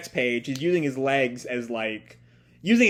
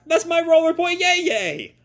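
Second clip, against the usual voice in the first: put-on much higher, squeaky-kid call; silly kid voice; 13 semitones higher than usual. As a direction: call out like an indignant little kid. Scooter kid calls after Ellie.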